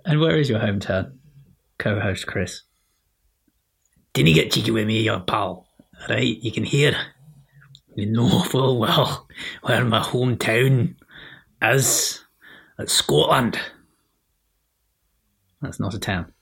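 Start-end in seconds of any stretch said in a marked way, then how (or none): 4.1-13.6 mock-threatening Glaswegian accent, louder; Glaswegian brogue. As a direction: act the menacing Scot with a broad Glasgow brogue. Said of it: "my threatening Glaswegian brogue".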